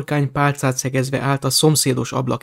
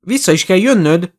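higher, insistent call; calling after; 5 semitones higher, 5 dB louder than usual. A narrator's reading. Harry calls up the stairs to Ginny, insistent shout. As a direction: call out a firm reminder after her. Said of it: "kiáltott utána Harry".

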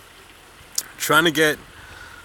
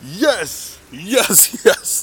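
loud, high "yes" cheers with laughter; excited yelling; 7 semitones higher, 5 dB louder, much slower than usual. Real, not acted. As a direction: cheer like the team just showed up. Celebrating friend's arrival.

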